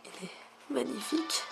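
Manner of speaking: high, soft awed whisper. Real, not acted. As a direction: marvel at it quietly. Awed at the Pokémon.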